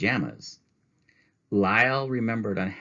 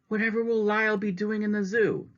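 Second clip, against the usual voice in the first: much higher, miserable pleading; dismayed lady. Acted. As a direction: protest in distress. Mrs. Prim dismayed.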